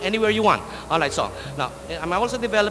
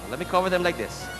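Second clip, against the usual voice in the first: normal talking voice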